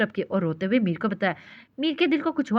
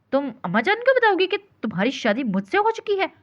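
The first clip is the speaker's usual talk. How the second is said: higher, incredulous, jaw clenched; through gritted teeth; 8 semitones higher than usual. A narrator's reading. Husband to wife about telling his mother, through gritted teeth. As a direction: hiss it in disbelief through your teeth. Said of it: "Mir ने दांत पीसे".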